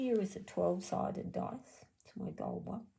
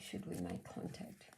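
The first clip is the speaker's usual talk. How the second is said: whisper; 11 semitones lower, 9 dB quieter than usual. Real, not acted. Asks cards again, whispered.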